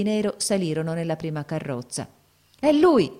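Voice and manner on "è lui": high, loud cry of recognition; sudden loud, high exclamation; excited cry. A narrator's reading. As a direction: cry out on spotting him. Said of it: "gridò Coretti".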